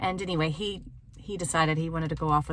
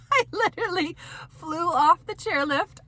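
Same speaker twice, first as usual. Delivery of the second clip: much higher, laughing through the words; 11 semitones higher than usual. Real, not acted. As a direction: burst out laughing as you tell it. Laughing retelling her fall.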